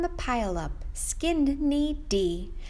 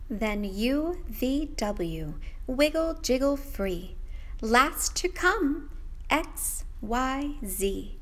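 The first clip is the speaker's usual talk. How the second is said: usual speaking voice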